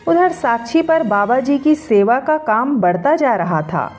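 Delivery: plain reading voice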